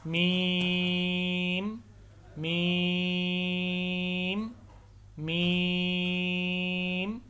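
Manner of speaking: میم held very long in an even chanting tone; drawn-out recitation. Shown as a demonstration. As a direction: hum میم out slowly, three times. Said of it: "اس کے بعد ہے میم". (میم کو تین سے پانچ الف کے برابر لمبا پڑھیں)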